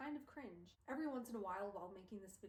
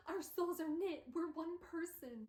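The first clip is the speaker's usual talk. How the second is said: high, louder acted declaration; impassioned insistence; 7 semitones higher, 4 dB louder, slower than usual. Will insisting to his friend on their bond, impassioned. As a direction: declare it dramatically, drawing out the end.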